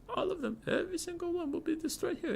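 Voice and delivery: put-on very high, hushed French-accented menace; mock French accent. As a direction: drop to a soft, squeaky French-accented threat. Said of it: "His French accent sounds Russian?".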